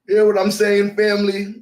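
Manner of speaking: rally the congregation with it; calling out. Pretend.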